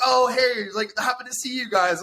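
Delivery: high-pitched mock-cheery hello; imitated friendly greeting. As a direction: imitate friends feigning a chance meeting. Imitates friends greeting them at table.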